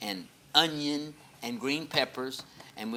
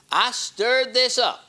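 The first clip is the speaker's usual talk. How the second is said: proud; 10 semitones higher, 9 dB louder, slower than usual. Real, not acted. Pastor proudly shows his cooking.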